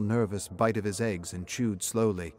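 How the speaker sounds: ordinary delivery, nothing marked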